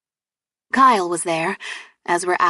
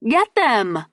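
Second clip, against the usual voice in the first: yelling; 4 semitones higher than usual. Acted. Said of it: "she yelled".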